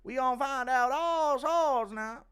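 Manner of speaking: high, put-on snooping voice; Happen Ace. Streamer acting someone tapping a phone, high loud caricature. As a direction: pitch it way up and push it. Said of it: "Impression of Happen Ace tapping your phone".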